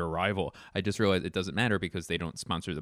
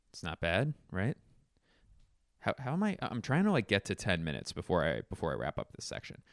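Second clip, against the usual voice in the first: ordinary delivery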